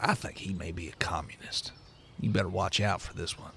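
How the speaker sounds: low, hushed aside; conspiratorial whisper. Acted. Leans over to warn the other two, conspiratorial whisper. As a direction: lean in and whisper a warning. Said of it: "he leans over to the other two of you".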